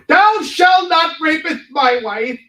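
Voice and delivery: mock Matt Damon's British knight, slow and lofty. Mocking Matt Damon's knight in a hammed-up high British accent. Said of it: "with a British accent".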